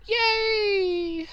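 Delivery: high, sarcastic mock cheer; sarcastic yay. Real, not acted. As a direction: cheer it with fake delight. Sarcastic cheer about allergies.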